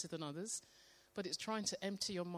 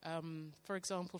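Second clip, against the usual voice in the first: ordinary delivery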